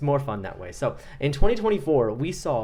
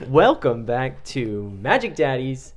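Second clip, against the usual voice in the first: show intro; 7 dB louder, slower than usual. Acted. Podcast show opening.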